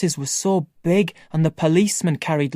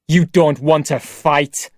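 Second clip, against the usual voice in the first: shouted; 4 dB louder than usual. Acted. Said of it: "Tony shouted".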